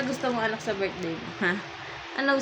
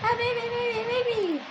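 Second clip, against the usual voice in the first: put-on high baby talk; 12 semitones higher, 5 dB quieter, much faster than usual.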